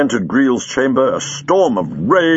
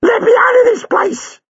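high, loud, near-crying panic; a terrified girl crying to get away; panicked terror; 5 dB louder than usual. Acted. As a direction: sob and cry out to escape. Girl terrified by Chang's poster.

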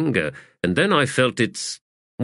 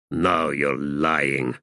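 lower, slow growled accusation; 6 semitones lower, much slower than usual. Acted. Callum accuses the wizard of lying, slow low growl. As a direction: drag it out low and menacing. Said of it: "Callum said in a growl".

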